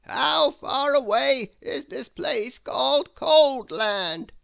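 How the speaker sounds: high, loud, slow theatrical wizard. Acted. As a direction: savour every grand word like an eccentric wizard. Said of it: "asked the wizard".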